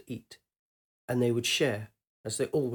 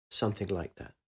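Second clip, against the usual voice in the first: put-on young man voice; 3 semitones lower, 7 dB quieter than usual. Man's low evasive reply.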